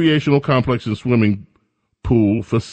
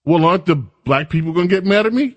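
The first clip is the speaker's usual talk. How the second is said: mock governor; 5 semitones higher than usual. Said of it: "the governor says".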